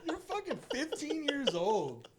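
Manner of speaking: very high, slow, laughing disbelief; incredulous laughing. Real, not acted. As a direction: squeal it out in mocking disbelief. Mocking reaction to weird kid.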